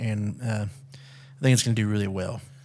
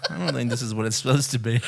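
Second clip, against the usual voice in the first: laughing; 4 semitones higher, faster than usual. Real, not acted. Laughing at brown toilet.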